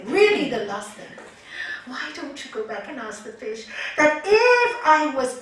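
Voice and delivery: storyteller's wife, loudly grandiose; insistent, greedy wife. Acted. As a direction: push for one last wish, building. Wife insists on one last wish.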